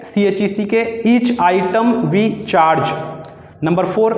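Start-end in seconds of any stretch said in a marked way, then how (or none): 1.1-3.0 slow dictation. Shown as a demonstration. Dictating shorthand exercise.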